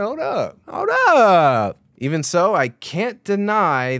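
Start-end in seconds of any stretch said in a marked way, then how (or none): 0.0-0.6 high-pitched, playful exclamation; excited interjection. Acted. Mock reaction to exciting animation. 0.7-1.8 loud, high, drawn-out exclamation; excited holler. Acted. Repeats the reaction louder.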